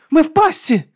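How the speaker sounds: high, loud shout; panicked cry. A narrator's reading. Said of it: "закричала Алекс".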